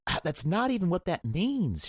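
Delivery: high angry scream. A narrator's reading. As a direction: shriek it at him in the parking lot. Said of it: "she screamed".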